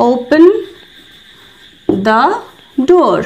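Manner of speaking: long pauses between words; teacher dictation; slow word-by-word demo. Shown as a demonstration. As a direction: say it so learners can copy. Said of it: "তো এটার জন্য বলবো".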